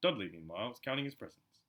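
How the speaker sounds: ordinary delivery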